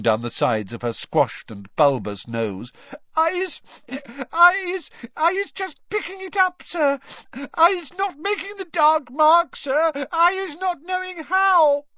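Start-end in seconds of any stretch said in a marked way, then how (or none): 3.2-11.9 very high, loud elf squeal with halting starts; squealing, tearful Winky. A narrator's reading. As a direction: plead in a squeaky, panicked little voice. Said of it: "squealed Winky, tears streaming".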